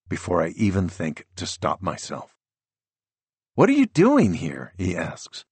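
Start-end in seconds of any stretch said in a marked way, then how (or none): 3.6-4.7 higher, startled son; teen boy voice. A narrator's reading. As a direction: voice the son, thrown and high. Teenage son surprised at dad.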